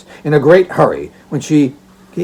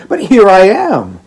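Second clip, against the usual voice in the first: put-on smiling girl; 6 semitones higher, 6 dB louder than usual. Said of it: "smiling as she spoke".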